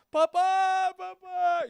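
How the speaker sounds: put-on calling out like a child; mock weeping cry.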